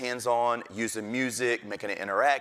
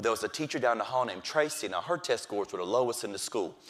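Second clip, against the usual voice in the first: usual speaking voice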